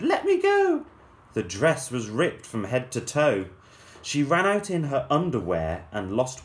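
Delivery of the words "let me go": put-on high, loud girlish shout; high desperate yell. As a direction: shriek to break free. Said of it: "As Cindy shouted".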